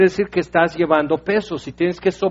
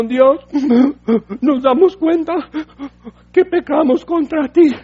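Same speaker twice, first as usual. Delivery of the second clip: high, sobbing plea to God, hammed up; mock tearful pleading; 9 semitones higher, 4 dB louder than usual. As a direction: beg forgiveness through exaggerated sobs. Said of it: "envueltos en lágrimas, diciendo". (llanto fingido y agudo pidiendo perdón)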